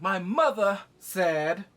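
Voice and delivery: loud, slow and pompous; mock Jace declaration. Son invoking his mother to the council, loud mock declaration. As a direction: draw out each word forcefully. Mocking Jace's solemn declaration.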